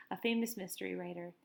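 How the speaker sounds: usual speaking voice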